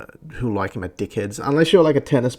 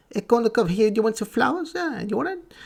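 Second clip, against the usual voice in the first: high-pitched, pestering mock suitor; horny Greek guy; 12 semitones higher than usual. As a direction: caricature an over-eager Greek admirer. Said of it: "horny fucking Greek guy".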